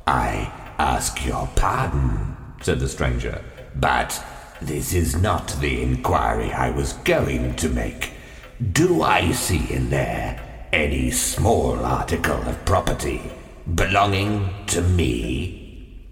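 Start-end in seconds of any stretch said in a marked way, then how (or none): 0.1-2.4 low, slow whispered menace; low looming threat. A narrator's reading. 3.7-16.1 low, slow goblin-like stranger; low looming threat. A narrator's reading.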